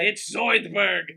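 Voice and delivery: loud, high, drawn-out cartoon voice; hammed-up cartoon impression; Zoidberg. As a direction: declare it slowly as a cartoon character. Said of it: "sounded like Zoidberg".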